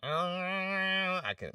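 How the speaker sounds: high, stretched whiny nasal tone. Shown as a demonstration. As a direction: drag it out in a whiny whine. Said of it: "And that whiny".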